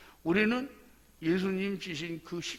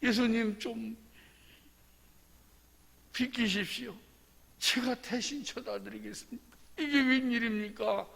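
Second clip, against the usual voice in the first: higher, acted near-tearful plea; tearful pleading; 5 semitones higher than usual. Preacher as Simon begging to take Jesus' cross, mock-tearful pleading. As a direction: plead as if choking up, with halting pauses. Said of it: "울먹이면서".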